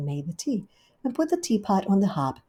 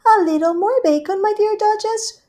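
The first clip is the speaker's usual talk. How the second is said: much higher, louder gushing hostess; Rivy's voice; 9 dB louder than usual. Acted. Rivy welcoming Duchess, high gushing hostess. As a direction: greet her sweetly and grandly.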